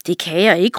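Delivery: plain reading voice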